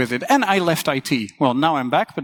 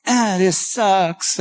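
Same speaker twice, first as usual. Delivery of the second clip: drawn-out, lazy grumble; slow mock complaint; 3 semitones higher, much slower than usual. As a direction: groan it out slowly, half-hearted. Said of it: "easier to yell and go".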